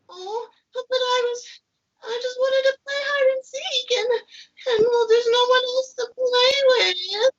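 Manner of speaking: high, disappointed pea voice; a little pea let down, high and sad; hurt puppet pea. Acted. Sugar Pea answering the refusal, high and disappointed.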